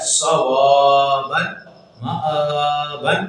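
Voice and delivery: slow drawn Quranic recitation. Shown as a demonstration. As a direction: recite each word deliberately. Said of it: "جیسا جو طریقہ بتا دیا گیا". (آہستہ تلاوت)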